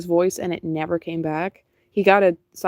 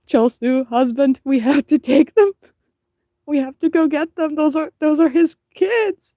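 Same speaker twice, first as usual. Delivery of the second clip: high, loud begging; pleading wife; 8 semitones higher, 5 dB louder than usual. Retold or imitated. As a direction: beg the husband to take the kittens. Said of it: "And I'm like".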